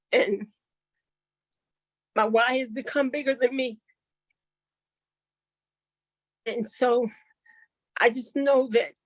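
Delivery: fighting to keep composure while moved; holding back tears. Real, not acted. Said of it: "it's hard not to get emotional".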